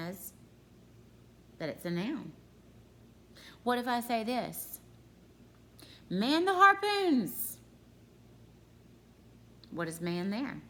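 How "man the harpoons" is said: high, loud, drawn-out battle cry; shouted command. Acted. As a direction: bellow it like an order to a crew. Example sentence acted as a command.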